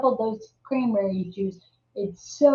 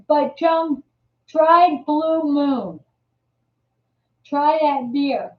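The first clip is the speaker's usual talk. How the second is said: urging; 4 semitones higher, 5 dB louder, slower than usual. Real, not acted. Urging viewer Joan.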